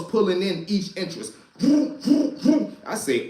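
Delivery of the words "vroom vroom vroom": high revving car noise; engine vroom. Retold or imitated. Mimicking police cars speeding into the lot, high vroom. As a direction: imitate cars racing in.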